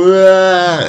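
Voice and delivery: high-pitched imitation of a child bursting into tears; mock crying wail. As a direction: wail like a toddler, put on. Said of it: "and start crying".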